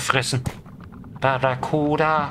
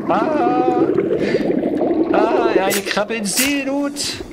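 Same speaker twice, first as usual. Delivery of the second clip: tipsy, slurring, pitched way up; drunk; 9 semitones higher than usual. Pretend. Crab gets drunk on alcohol, high tipsy voice. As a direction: play the crab getting drunk. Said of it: "jetzt wird's lustig".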